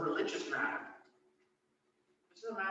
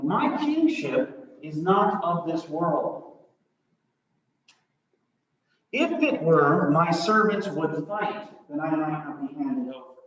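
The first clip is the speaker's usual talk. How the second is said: Jesus' answer; 10 dB louder, slower than usual. Acted. Jesus' answer, slow and loud.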